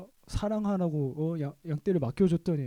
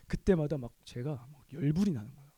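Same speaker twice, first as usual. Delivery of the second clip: ordinary delivery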